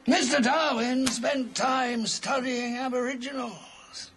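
ordinary talk, nothing marked

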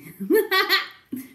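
a sudden high, loud burst of laughter. Real, not acted. Laughing in disbelief at what a vlogger earns. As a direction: crack up in disbelief. Laughing at the Chanel bag price.

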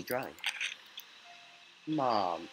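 ordinary talk, nothing marked

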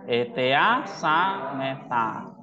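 Etea sameta drawn out very slowly, syllable by syllable; slow reading demo. Shown as a demonstration. Teacher modelling Greek syllable reading, very slow. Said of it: "belajar baca suku kata nih".

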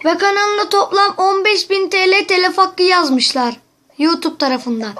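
yüksek, yüksek sesle telaş; endişeli haber verir gibi; telaşlı oyunculuk. Acted. Kuzenine telefon şakası. (kuzenine telif haberini telaşla veriyor)

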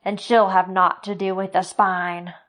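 loud angry curse; cursing. Acted. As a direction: curse him again. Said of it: "The butcher cursed again".

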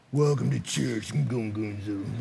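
low, slow, soft grumble with gongunzo mumbled; grumbling greeter. Retold or imitated. Pastor mocking a volunteer greeting grudgingly, low grumble. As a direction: drag it out like a sulky door holder. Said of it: "grumbling in Greek is gongunzo".

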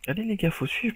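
high, loud call to the team; yelling at teammates. Real, not acted. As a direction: urge the squad on, shouting.

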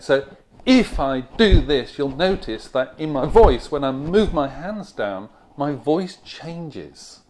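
loud, punched stresses on each beat; exaggerated beat stress. Shown as a demonstration. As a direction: hit every beat hard as the hands come down. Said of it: "let me demonstrate".

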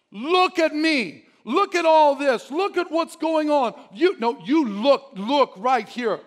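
high, loud, slow demanding complaint; frustrated plea. Acted. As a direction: insist that God pay attention. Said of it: "felt like God's not looking at you".

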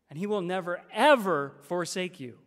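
"ever" punched loud after "never"; full-force emphasis. Shown as a demonstration. Preacher restating God will not forsake you, full-force emphasis. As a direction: hammer the word "ever". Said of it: "get the full force of it".

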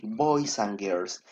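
ordinary talk, nothing marked

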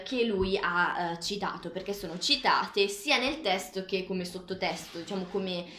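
normal talking voice

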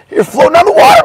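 ordinary talk

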